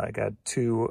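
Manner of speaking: normal talking voice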